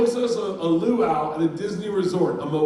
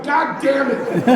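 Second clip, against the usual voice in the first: high, loud, drawn-out curse; mock exasperation; 10 semitones higher, 6 dB louder, much slower than usual. Comedian learns his drinks had no alcohol, exasperated mock cry. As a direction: stretch out the curse in hammed-up frustration.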